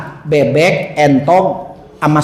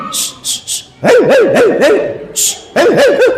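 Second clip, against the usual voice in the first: shooing a dog; 7 semitones higher than usual. Acted.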